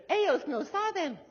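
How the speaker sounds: put-on high-pitched retelling of a child's voice; imitated child. A child confiding in a friend, imitated in a high voice. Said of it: "out of the mouths of babes".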